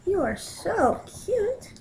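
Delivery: high, slow, soft cooing. Real, not acted. Owner cooing at her cat, high slow baby talk.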